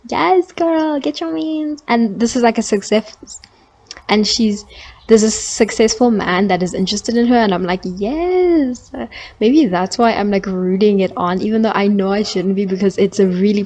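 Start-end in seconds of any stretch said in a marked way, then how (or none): 0.1-1.8 high, gleeful cheer; high-pitched hype for the heroine; cheering yes girl. Retold or imitated. Cheering a TV romance on. 7.8-8.9 high, delighted yes; gleeful yes. Retold or imitated. Cheering on the romance.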